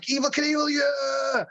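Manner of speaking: high cheering call; crowd cheer. Retold or imitated. Parade crowd cheering the Evel Knievel truck, high cheer.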